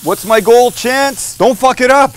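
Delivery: high, loud call across the grill; shouted goal. Real, not acted. Cook yells to cameraman Chance, loud and joking. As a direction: yell it to the crew, half joking.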